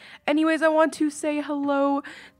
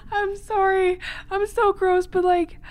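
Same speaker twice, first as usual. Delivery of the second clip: giggly apology; 10 semitones higher, slower than usual. Real, not acted. Still laughing after story.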